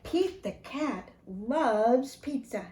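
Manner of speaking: usual speaking voice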